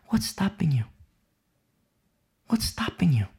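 unvoiced whisper, pointed; whispered challenge. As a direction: lean in and whisper the question. Preacher pressing listener, whispering.

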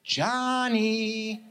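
high, long drawn-out call; little girl calling. Retold or imitated. Little girl summoning big brother, high drawn-out call. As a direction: sing out the name to a brother upstairs. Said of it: "I hear her say".

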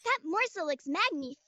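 light French cat princess; a hint of French accent, dainty. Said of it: "always speaking in a little bit of French".

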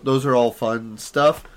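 ordinary talk

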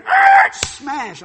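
loud squealing screech into impact; very loud crash sound effect; imitated car crash. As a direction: mimic tires, then a big smash.

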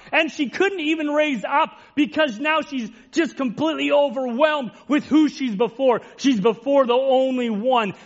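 fervent sermon delivery; impassioned preaching. Acted. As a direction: preach it out with fervour, climbing. Climax of the sermon.

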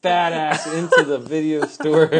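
put-on whiny demanding kid voice; acting the spoiled teenager; bratty teen.

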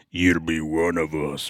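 low, slow monster voice; ominous wolf voice. Retold or imitated. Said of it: "it said".

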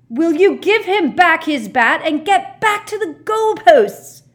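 high, very loud, drawn-out bellow; outraged full-force yell; furious roar. A narrator's reading. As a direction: roar an order across the pitch. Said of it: "roared Harry".

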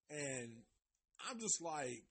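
ordinary talk, nothing marked

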